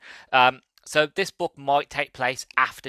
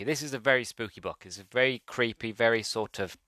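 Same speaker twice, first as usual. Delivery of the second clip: ordinary talk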